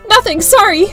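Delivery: high, loud, panicked blurt; flustered apology. Acted. Newcomer caught staring, flustered apology.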